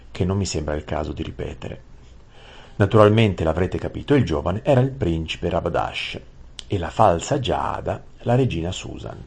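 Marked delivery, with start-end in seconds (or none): none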